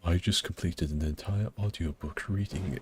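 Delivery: Vaddy impression. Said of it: "is Vaddy trying to sell".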